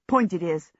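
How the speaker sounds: plain reading voice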